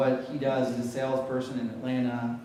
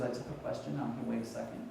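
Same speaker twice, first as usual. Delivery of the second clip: hushed, much softer retold aside; quiet whisper; 4 semitones lower, 10 dB quieter, faster than usual. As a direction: imitate someone quietly asking, voice dropped. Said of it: "she just quietly whispers".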